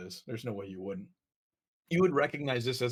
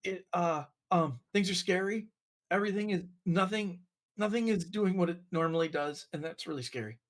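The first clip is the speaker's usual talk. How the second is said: higher, anxious fish voice; a timid fish spilling its fears; scared little fish; 6 semitones higher than usual. Acted.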